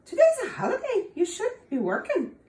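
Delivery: high, laughing, sugary coaxing; sweetly coaxing. Acted. Tom sweetly coaxing the working leprechaun, high and laughing. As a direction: coax him off his work sweetly. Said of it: "smiled very sweetly and said".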